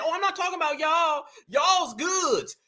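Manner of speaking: prim put-on Caribbean accent, shouted; mock Caribbean accent. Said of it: "They'll kick into that accent real quick".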